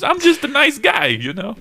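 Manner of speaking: high, loud comic protest; Hitler. Acted. Comic acting out Hitler's side, high mock protest. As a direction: plead innocence in a squeaky put-on voice. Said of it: "tell it from his perspective".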